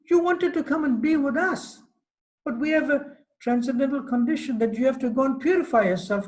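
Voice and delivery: mock-offended beloved; scolding Radharani. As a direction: scold him as if he's gone crazy. Radharani scolds Krishna for arrogance.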